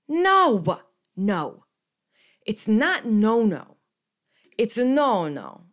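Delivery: loud, high, emphatic imitated grandpa; scolding grandpa correction. Grandpa correcting granddaughter's Italian, loud imitated scolding. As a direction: correct her loudly, leaning on each no.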